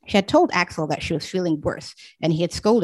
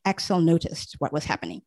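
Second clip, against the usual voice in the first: ordinary delivery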